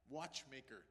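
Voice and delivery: usual speaking voice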